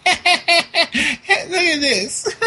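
high, drawn-out laughing mockery; imitating giggling preteen. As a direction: laugh through it like a silly kid. Imitating preteens cackling while playing.

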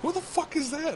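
high, mock outraged shout; shrieked mock outrage; angry boyfriend. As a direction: play the fake jealous boyfriend. Friend acts angry boyfriend.

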